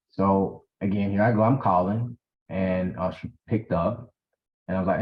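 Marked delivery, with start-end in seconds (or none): none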